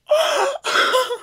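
sharp high excited gasp; a big shocked gasp. Real, not acted. Gasping on hearing the old scammer.

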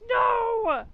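loud high cry of no; real alarmed yell; dismayed shout. Real, not acted. Reacting to wasp attack.

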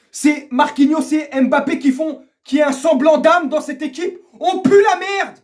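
high, loud, building to a yell; angry rant. Real, not acted. Fan disgusted with his soulless team, shouting.

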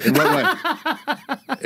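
slow, high, laughing question; laughing disbelief. Real, not acted. Friend laughs at the Alex Jones claim, disbelieving. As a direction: laugh through the words in disbelief.

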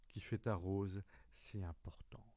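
whispered secret, no voice. Acted. Fox whispering the secret to the little prince. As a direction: breathe it like a secret.

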